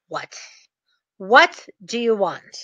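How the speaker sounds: slow, sighing mock defeat; sigh, then give in reluctantly; shrugging resignation. Bank rep giving in to the sender, resigned. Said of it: "that shoulder shrugging".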